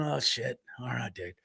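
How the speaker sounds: low, soft retold resignation; quiet, sinking mock surrender; resigned aside. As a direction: give in under your breath. Retelling his reluctant giving in.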